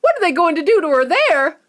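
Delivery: high loud frantic question; horrified mother. Acted. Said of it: "cried Missus Beauregard".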